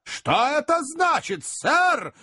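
slow demand pitched very high; acted indignant outburst, stretched out. A narrator's reading. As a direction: repeat it threateningly, voice climbing. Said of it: "грозно повторил мистер Магнус, повышая голос".

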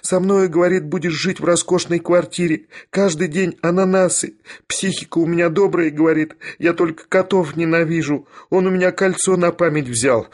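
high, plaintive wail; crying. A narrator's reading. Young lady pours out her grievances to the professor, crying. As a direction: keep up a tearful high-pitched complaint. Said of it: "плакала барышня".

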